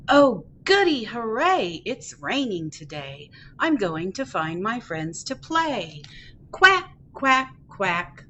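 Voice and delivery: happy duckling, picture-book reading. Acted. Ducky off to play in the rain, happy read-aloud. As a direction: read playfully to toddlers, bouncing the quacks.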